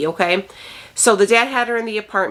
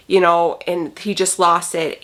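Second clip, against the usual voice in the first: usual speaking voice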